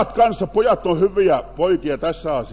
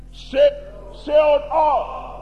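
shout; 6 semitones higher, much slower than usual. Shown as a demonstration. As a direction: show the men how to shout it. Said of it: "Huutakaas, veljet".

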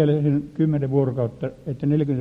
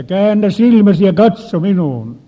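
forceful shouted command, much louder; 4 semitones higher, 9 dB louder than usual. Retold or imitated. Preacher ordering the possessed woman, loud and forceful. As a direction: bark it out to break the spell.

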